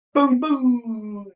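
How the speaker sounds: high, loud boom boom; imitated gunshots. Recounting the son's shot to a friend, loud mock gunshots. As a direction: blurt out the gun blasts. Son fires the gun.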